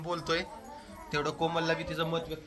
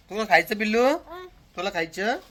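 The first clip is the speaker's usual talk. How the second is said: put-on higher rising questions to a toddler; baby talk; 5 semitones higher, 6 dB louder than usual. Father asking his toddler if she wants to eat, sing-song. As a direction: offer the food in a sing-song ask.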